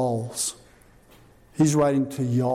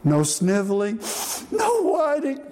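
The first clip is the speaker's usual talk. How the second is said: lightly mimicked child chanting the rules, last words jumping much higher; kids reciting rules; 6 semitones higher than usual. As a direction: recite it like a little grandkid, singsong. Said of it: "I would go, "What are the rules?".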